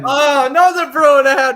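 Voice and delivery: loud, high mock disgust; a playful fake grudge. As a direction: pretend to hate him, hammed up. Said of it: "No, I'm kidding".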